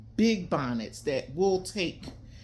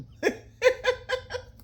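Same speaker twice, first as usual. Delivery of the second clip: high laugh; 6 dB louder than usual. Real, not acted. Laughs mid sentence.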